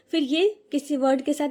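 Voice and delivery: usual speaking voice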